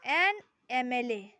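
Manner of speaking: एन एम एल ए spelled out very slowly and loud; slow example. Shown as a demonstration. Teacher announcing the example. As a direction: drag out each letter. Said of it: "For example".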